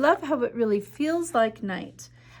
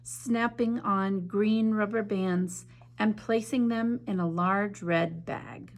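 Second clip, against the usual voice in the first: story narration. A narrator's reading. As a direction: read it like a bedtime story to children. Reads picture book aloud.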